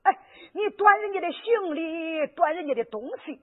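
slow, crying lament over the robbers; old woman weeps for the robbed young man; tearful lament. Acted. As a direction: wail over the cruel thieves.

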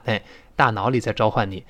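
ordinary delivery

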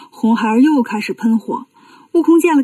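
usual speaking voice

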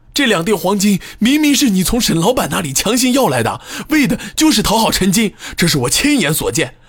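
high, fast heated rebuttal; indignant accusing. Acted. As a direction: rattle off the accusation fiercely. Witness angrily refutes accusation.

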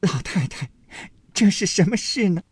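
put-on high, tearful young girl's voice; tearful plea. A narrator's reading. Daiyu pleads through tears with grandmother, high tearful voice. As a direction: cry out in disbelief. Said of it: "黛玉哭道".

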